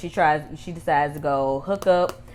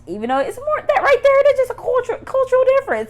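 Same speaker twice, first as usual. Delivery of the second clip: much higher, louder, worked-up exclamation; excited squeal; 7 dB louder than usual. Real, not acted. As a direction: squeal it out in disbelief. Exclaiming over the cultural difference.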